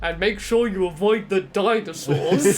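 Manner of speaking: slow, stilted game voice actor; deliberate, hammy instructions, spaced out; Barry from Resident Evil. Acted. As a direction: wooden video-game hero voice. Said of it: "that sounds like Barry".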